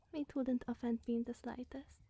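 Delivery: usual speaking voice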